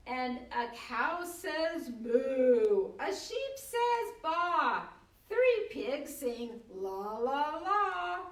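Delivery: slow, lively read-aloud with animal sounds; reading a picture book aloud, animated; reading to children. Acted. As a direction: read it big and playful for a toddler. Reads board book aloud.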